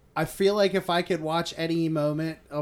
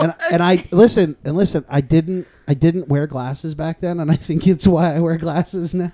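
laughing; 2 semitones higher, 9 dB louder than usual. Real, not acted. Laughing through funny story.

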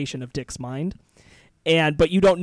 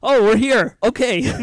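excited; 7 semitones higher, 7 dB louder, slower than usual. Retold or imitated. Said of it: "And I'm like".

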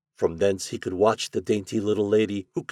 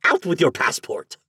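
water rat; 8 semitones higher, 5 dB louder than usual. A narrator's reading. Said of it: "said the rat".

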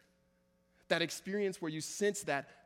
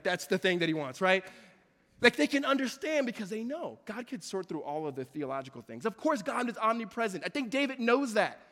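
ordinary talk

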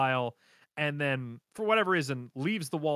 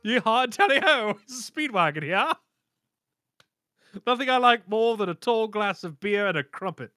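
louder, higher hammed-up Texan-British; half twang Brit; 6 semitones higher, 5 dB louder than usual. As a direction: play Speedwagon posh with a twang. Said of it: "got, like, a half twang".

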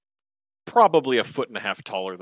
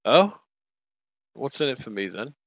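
smirking. Acted. Manta bargaining with the half-orc, mildly smirking. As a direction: play coy and smirk. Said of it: "smirks for a second".

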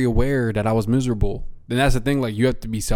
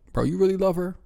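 skeptical, higher-pitched question; retold disbelief, pitched up; doubting friend; 8 semitones higher, 4 dB quieter than usual. Acting out doubting his friend.

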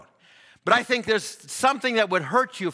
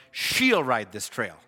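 she'll stretched out, falling low after; stress on she'll; 7 semitones lower, slower than usual. Shown as a demonstration. Preacher showing congregation the third reading, she'll slowly emphasised. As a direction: lean long on she'll, then drop. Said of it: "he says it three ways".

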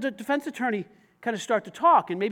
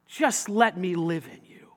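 gentle plea; 3 semitones lower, slower than usual. Acted. Jesus' gentle invitation, first take.

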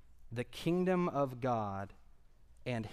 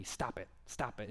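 usual speaking voice

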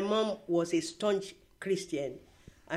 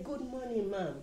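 quiet polite greeting; 4 semitones higher, 6 dB quieter than usual. Retold or imitated. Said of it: "when I say".

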